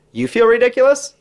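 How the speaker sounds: high-pitched mock disbelief; incredulous retort. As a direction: toss it back as an incredulous question. His incredulous retort in anecdote.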